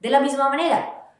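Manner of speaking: normal talking voice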